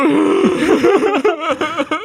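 a loud, high burst of laughter; high laughing burst. Real, not acted. Retelling her wedding tears, she bursts out laughing.

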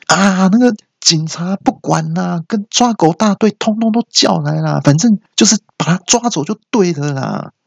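high-pitched hollering without much volume; mock yelling bystander. Bystander calling for police and dog catchers, mock yelling. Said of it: "又有人在喊说".

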